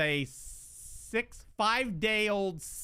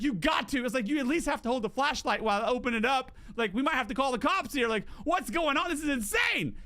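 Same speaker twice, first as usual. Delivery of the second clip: retold frantic plea; 6 semitones higher, faster than usual. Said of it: "I was like".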